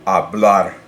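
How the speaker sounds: Spanish Hablar, silent H, weight on BLAR; stress on last syllable. Shown as a demonstration. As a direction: punch the final syllable of Hablar. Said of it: "its natural stress is already".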